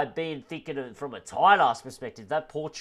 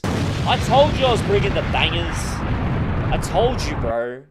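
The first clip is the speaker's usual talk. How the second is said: TOLD punched, louder and higher; hyped-up bro repeating himself louder; louder insistent repeat; 3 semitones higher, 6 dB louder than usual. Shown as a demonstration. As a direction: hammer it home the second time.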